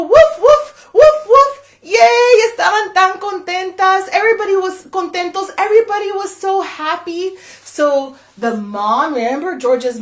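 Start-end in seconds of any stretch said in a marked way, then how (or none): 0.0-1.6 very high, loud woof woof; joyful puppy barks; dog barking. Acted. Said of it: "Jorge ladró y dijo". 1.8-7.4 high, loud happy narration; celebrating the happy ending; cheering. A narrator's reading. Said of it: "everyone happy, celebrating".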